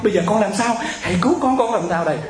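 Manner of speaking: high, wailing plea, imitating the caller; crying out. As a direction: act out a helpless, desperate cry for help. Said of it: "Tôi thấy chị gào lên, chị khóc". (giả giọng kêu khóc cầu cứu)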